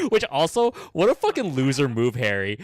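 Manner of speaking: loud, high worked-up retelling; excited rant. Real, not acted. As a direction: rant loudly about the loser move. Ranting loudly about the scene.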